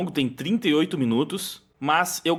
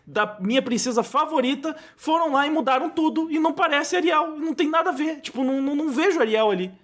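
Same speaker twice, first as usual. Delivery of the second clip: high-pitched whiny imitation of a complaining fan; upset friend; 9 semitones higher than usual. Retelling a feminist friend's complaint about the Little Mermaid, upset, high-pitched imitation. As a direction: mimic an upset friend's lament, pitched well up. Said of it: "ela ficou muito chateada".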